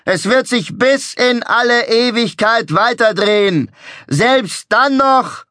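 high, loud, slow proclamation; ecstatic showman. A narrator's reading. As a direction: proclaim it like a prophet. Showman proclaiming his invention.

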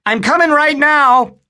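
put-on boy calling down to mom. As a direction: shout it down to stall mom.

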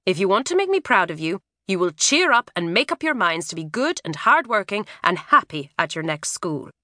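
usual speaking voice

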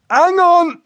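very high, loud urgent yelp; Joe Windass interrupting. A narrator's reading. Joe interrupts the paramedic, yelping high and urgent. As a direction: cut in urgently as trouble strikes. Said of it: "he said, interrupting me".

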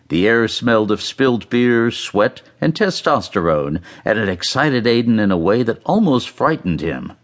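ordinary delivery, nothing marked

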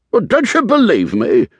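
high, loud, indignant challenge; offended outburst, pitched right up; indignant snort. A narrator's reading. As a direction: snort it out, affronted. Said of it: "Sir Richard snorted".

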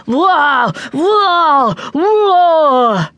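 high, falling gorilla whoops; gorillas answering. A narrator's reading. Gorilla family answers the boy on the wall, whooping loudly. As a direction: whoop back like a troop of gorillas. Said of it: "the gorillas answered".

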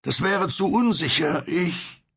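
high, halting voice that stops mid-sentence; hesitant, breaking off. A narrator's reading. Unseen stranger in a boat refusing, hesitant and breaking off. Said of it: "Die Stimme brach ab".

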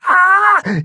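high, loud pained gasp. A narrator's reading. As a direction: cry out as the arm is twisted. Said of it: "he gasped out in pain".

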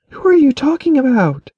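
she demands to be let in on it; Keliana's voice, confused. A narrator's reading. Daughter questions father and Edric, high and bewildered.

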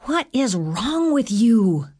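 slow, high-pitched accusation; accusing. A narrator's reading. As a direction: stretch the question out high, demanding an answer. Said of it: "she accused".

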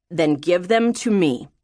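lightly voiced commanding father; stern father. Father demanding letters from daughter, mildly stern. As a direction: give a firm order with a hint of fatherly authority.

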